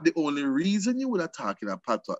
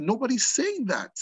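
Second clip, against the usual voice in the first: high-pitched protest, falling at the end; exasperated retort; 7 semitones higher than usual. Retold or imitated. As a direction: push back in a higher voice. Retold protest to father.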